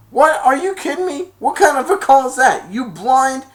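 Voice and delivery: high, loud outraged shouting; angry protest. Retold or imitated. Spike protesting the call to the ref, high outraged shout. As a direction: yell at the ref in disbelief.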